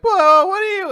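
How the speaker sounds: very high, loud mock alarm; hammed-up flustered whoa; startled yelp. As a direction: blurt it in exaggerated shock. Startled character reacts to date offer.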